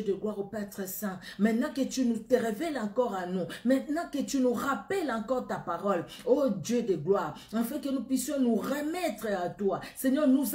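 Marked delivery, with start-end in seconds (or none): none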